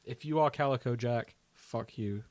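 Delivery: ordinary talk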